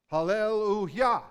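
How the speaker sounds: loud, high Hallelujah, hard stress on the final "jah"; emphatic Hebrew-style cry. Acted. Preacher voices the praise to God, emphatic cry of Hallelujah. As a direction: cry out Hallelujah, landing forcefully on its last syllable. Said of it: "would be their cry over times".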